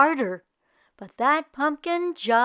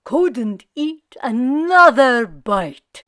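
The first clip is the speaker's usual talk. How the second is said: full vampire; 8 dB louder, slower than usual. Acted. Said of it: "said the vampire".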